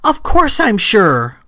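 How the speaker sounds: high, exasperated outburst; near hysterics. A narrator's reading. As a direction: burst out as if close to losing control. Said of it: "Sam was close to hysterics".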